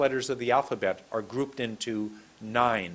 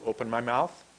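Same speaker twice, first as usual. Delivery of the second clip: ordinary talk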